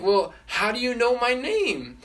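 high-pitched, incredulous puppet; bewildered, pitched well up; baffled question. Retold or imitated. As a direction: ask in acted astonishment, voice lifted. Puppet puzzled that stranger knows him.

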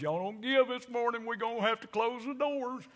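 mock preacher plea. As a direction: ham up a desperate preacher. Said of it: "the worst thing a preacher can do".

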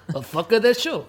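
failed attempt at a French accent; mock French accent, half sumo half Borat. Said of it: "I can't do a French accent".

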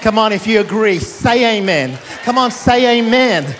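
loud, driving call-and-response preacher; rally the room with rising, punched exhortations; full-force preaching. Real, not acted. Pastor urging the congregation to say amen, full-force preaching.

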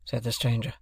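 plain reading voice

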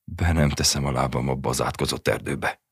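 ordinary delivery

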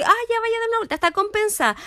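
high-pitched dismissive staff voice; mock caregiver. Imita al personal psiquiátrico. (imitando al personal, en tono agudo)

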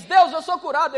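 loud, ringing cry of faith; bold declaration. Pretend. Believer proclaiming healing to God, loud and bold. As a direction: shout the claim up to God.

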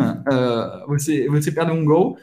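ordinary talk

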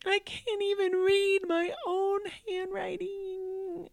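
put-on high, slow, crying child's voice; sobbing kid. Mother voicing her son sobbing over his handwriting, crying. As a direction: wail it through tears. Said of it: "he just was, like, sobbing".

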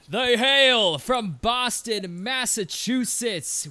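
loud, high, slow hype announcer; announcer intro. Acted. Host introducing guest, announcer hype. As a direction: build up the guest like a showman. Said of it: "I start with this intro".

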